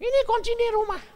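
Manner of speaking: wife yelling to her husband to help; wife screaming. Retold or imitated. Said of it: "Dia teriak-teriak".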